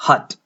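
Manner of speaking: हट said crisply with a short neutral vowel, British style; model pronunciation. Shown as a demonstration. Teacher modelling the word for students, clear and deliberate. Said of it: "H U T को pronounce करें".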